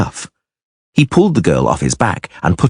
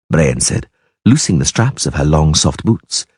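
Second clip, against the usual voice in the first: usual speaking voice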